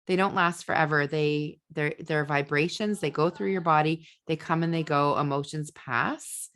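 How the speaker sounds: ordinary talk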